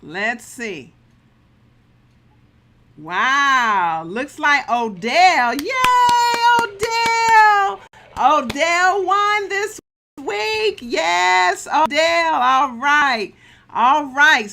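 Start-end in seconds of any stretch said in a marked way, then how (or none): 3.0-14.5 loud and high, cheering the winner; big excited cheer, pitch jumping up; hyped giveaway announcement. Real, not acted. Live giveaway winner reveal.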